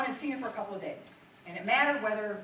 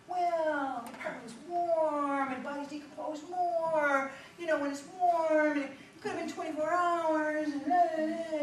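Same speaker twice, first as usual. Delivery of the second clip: higher, slow mock-whiny prosecutor voice; 8 semitones higher, slower than usual. Prosecutor trying to mush the timeline, mocked in a higher, slow voice. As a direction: parody the prosecutor mushing the facts. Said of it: "The prosecutor gets up, he tries to kind of mush it".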